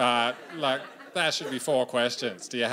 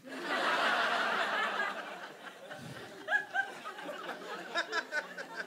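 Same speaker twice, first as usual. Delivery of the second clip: high breathy laugh; a long real giggle at his own joke; crowd-pleasing giggle; 7 dB quieter than usual. Real, not acted.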